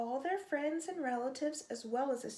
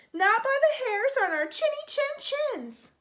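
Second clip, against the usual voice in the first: high, loud defiant refusal; the little pigs refuse the shark, high and defiant; 11 semitones higher, 8 dB louder than usual. A narrator's reading. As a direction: squeak out the refusal, high and bold.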